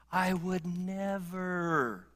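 drawn-out, hushed I would never; slow, quiet vow. Retold or imitated. Preacher voicing his own quiet vow slowly. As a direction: savour each word, slowly and softly. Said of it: "I find myself saying that".

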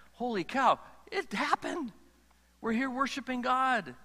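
high, excited, marvelling; amazed exclamation. Pretend. Believer realizing it all came true, high and amazed. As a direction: burst out in pretend wonder.

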